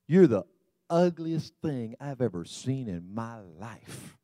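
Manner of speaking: slow, drawn-out deadpan joke insult; deadpan parrot insult. Acted.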